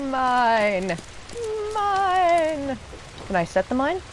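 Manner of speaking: ordinary delivery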